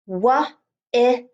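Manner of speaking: "Wa-i-l" as three separate sounds, held l; sounded out. Shown as a demonstration. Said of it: "That l sound is two L's".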